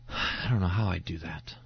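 low, deflated admission after a sigh; sighing doubt. Acted. Preacher acting out an onlooker's reaction, low sighing doubt. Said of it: "we all kind of do this".

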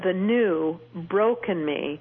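slowed right down, each word weighed; slow, heavy. Real, not acted. Telling the host how she has changed, slow and heavy. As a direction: let the words sink slowly. Contrasting her old and new self.

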